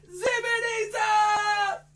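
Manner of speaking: high loud slow zippity zap; magic shout. Pretend. Magician casting spell for kids, loud slow shout. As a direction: cast the spell loudly.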